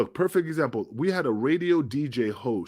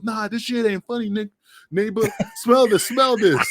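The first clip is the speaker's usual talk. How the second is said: higher, pushier mock Jack; voicing angry Jack; 8 semitones higher, 4 dB louder than usual. Storyteller acting out Jack telling Nick to smell it, high and pushy. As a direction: play Jack shoving it at him, pitched up.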